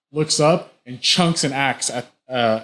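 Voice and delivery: usual speaking voice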